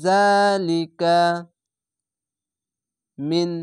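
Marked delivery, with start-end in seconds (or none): 0.0-1.2 জালিকা drawn out slowly, the long a held; চার্টে টেনে পড়া জালিকা; stretched vowel. Shown as a demonstration. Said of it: "এক আলিফ টেনে পড়বেন". (টেনে টেনে ধীরে পড়া)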